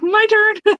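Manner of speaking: high eager squeal; excited squeal. Retold or imitated. Isabella jumping into the group hug, high excited squeal. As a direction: squeal it like a kid wanting a hug. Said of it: "Isabella's like".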